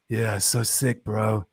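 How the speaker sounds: low, lazy New York bro; crypto bro voice. Retold or imitated. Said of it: "N Y C crypto bro voice".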